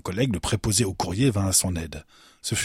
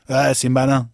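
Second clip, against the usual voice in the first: loud, higher-pitched grumble; grumbling; 5 semitones higher, 7 dB louder than usual. A narrator's reading. Clerk dismissing the visitor's joke, grumbling. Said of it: "grogna le préposé".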